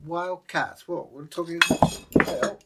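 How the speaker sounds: usual speaking voice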